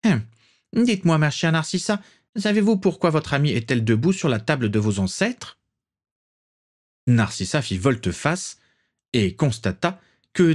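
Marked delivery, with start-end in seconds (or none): 0.0-5.6 higher, lofty grande-dame voice; imperious old aristocrat, a touch theatrical; haughty old matriarch. A narrator's reading. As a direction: look down on the young bride. Prisca voice continues.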